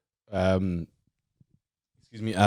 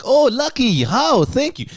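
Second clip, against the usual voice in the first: much higher, louder delighted hello; high excited greeting; 13 semitones higher, 4 dB louder, slower than usual. Retold or imitated. Neighbor greets Lucky at the door, high and delighted. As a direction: gush over seeing Lucky again.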